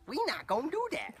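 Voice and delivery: very high, loud mock scolding; playful loud refusal. Trainer to a balking horse, squealed mock scolding.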